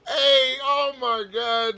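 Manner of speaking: high, drawn-out words through real laughter; helplessly cracking up, slowly; laughing hard. Real, not acted. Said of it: "This is not that funny".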